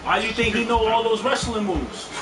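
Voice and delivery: usual speaking voice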